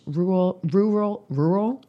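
tongue-tied attempts at "rural", last one questioning; struggling pronunciation. Real, not acted. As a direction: stumble over "rural", retrying it. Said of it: "That's a hard word to say".

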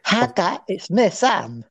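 breathy mock voice of a teenage boy; put-on fourteen-year-old's voice; young Sam impression. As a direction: imitate your friend's younger self, airy and put-on. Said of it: "that was your voice as a fourteen-year-old".

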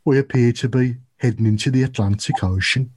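mock Brummie pilot announcement; caricatured Birmingham accent over the intercom. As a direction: play an unfortunate Brummie airline pilot. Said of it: "your pilot have a Birmingham accent".

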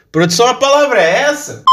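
high fast call to the crew; quick high aside off to the side; calling off-mic. Real, not acted. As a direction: shout the question to production. Aside shouting to production crew.

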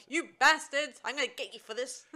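a loud furious shout, then a rush; mock angry yell. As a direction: Marion screaming threats from the pit. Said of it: "she's like".

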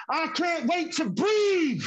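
pained shout. Acted. Poem climax.

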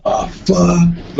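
retold terror, voice hushed and stretched; frozen in fear. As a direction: let the fear choke the words. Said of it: "I was frozen in fear".

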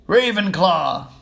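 loud, drawn-out announcement; slow, carrying shout; shouted verdict. Acted. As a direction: shout it slowly across the hall. Hat shouts out the house.

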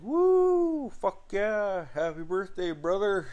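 high, whooping party greeting; excited whoop. Retold or imitated. Friend greeting the birthday brother with beer, high excited whoop. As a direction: cheer him on like the party just started.

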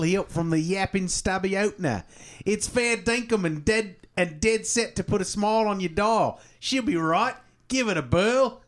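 put-on broad Australian accent. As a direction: read it out in thick Aussie slang. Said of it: "here's the back of it".

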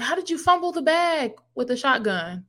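mock-scold someone who blew an easy chance; exasperated disbelief. Host scolding the shopkeeper who lost, in exasperated disbelief.

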